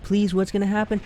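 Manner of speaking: hyped-up suspenseful plea; retold pleading. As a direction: plead to know the outcome, acted out. Retells begging at the climax.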